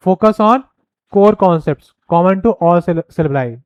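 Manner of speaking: very slow, each word spaced out; dictating a point; slow dictation. Shown as a demonstration. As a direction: read it slowly so students can write. Reading slide point aloud.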